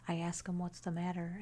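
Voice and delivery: usual speaking voice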